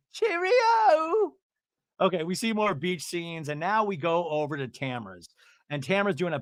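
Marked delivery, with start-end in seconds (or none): 0.1-1.3 very high, drawn-out mock British trill; hooting, hammed-up London farewell; Shannon. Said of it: "And she goes".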